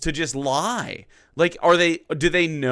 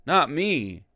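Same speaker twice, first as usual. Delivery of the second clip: ordinary delivery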